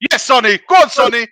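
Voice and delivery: willing the winger forward; rising excitement. Real, not acted. Fan cheering Sonny as he runs at Trippier, building excitement. Chance building toward goal.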